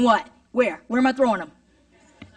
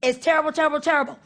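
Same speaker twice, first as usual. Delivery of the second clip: ordinary delivery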